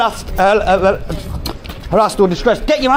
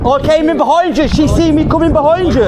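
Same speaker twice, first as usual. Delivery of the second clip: raised, higher voice calling across; yelling across the room; 7 semitones higher than usual. Real, not acted. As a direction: call it out to someone across the room. Calling over to other officer.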